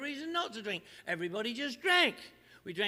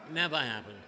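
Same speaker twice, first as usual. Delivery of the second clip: low deadpan; 9 semitones lower than usual. Real, not acted. Deadpan punchline.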